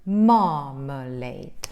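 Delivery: marmalade slow, with a long strong MAR; first-syllable stress. Shown as a demonstration. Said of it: "You stress the first syllable".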